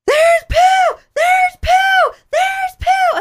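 put-on high shrill kid yell, repeated; child shouting. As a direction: shout it like a panicked little boy. Son shouting in retold story.

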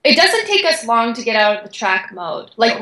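ordinary talk

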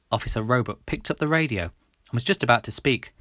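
plain reading voice

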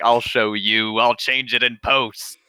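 a lightly put-on defiant director voice; hint of a smug filmmaker; defiant Lucas. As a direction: play him as a stubborn creator brushing off the critics. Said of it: "He was like".

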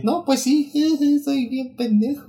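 high, slow mock admission; mock sheepish. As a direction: play it dumb and sheepish. Mock self-deprecating quoted reply.

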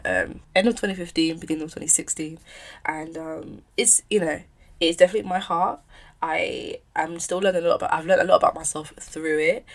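normal talking voice

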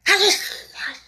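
urgent, high, breath-filled hush; hurried hushing. A narrator's reading. The Rabbit silences Alice, hurried and anxious. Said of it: "in a low, hurried tone".

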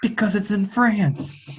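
slow, dry delivery; deadpan punchline. Acted. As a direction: land the joke slowly and dryly.

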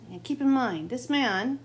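normal talking voice

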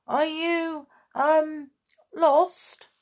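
high, halting timid question; nervous stammer. Acted. Bernard questioning the kitten he fears, nervous stammer. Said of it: "he stammered".

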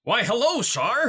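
high, loud theatrical hello; hammed-up greeting. Player hails Shar after being backed into, hammed-up greeting. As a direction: call it out big and high in mock delight.

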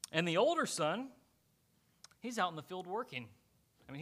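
normal talking voice

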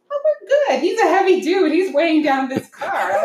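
high, comic inner voice; high-pitched self-mocking thought, laugh creeping in; mock confident reassurance. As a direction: act out naive confidence, amused. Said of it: "that's what I'm thinking".

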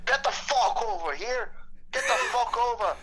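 high-pitched mock Scottish shout; hammed-up Scottish accent, pitched well up; Derek McInnes shouting in Scottish accent. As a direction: imitate an irate Scottish yell, badly. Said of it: "started shouting over in a Scottish accent".

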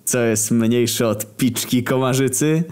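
stretched-out, pleased-with-himself setup; tipsy joke-telling. A narrator's reading. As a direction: pose the joke like a merry drunk. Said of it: "Obaj już lekko podchmieleni".

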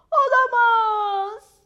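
high, loud, drawn-out dismayed wail; worried cry. A narrator's reading. Maya the dog finds a splinter in her paw, worried cry. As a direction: cry out in alarm, stretching it. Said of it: "diye bağırdı endişeyle".

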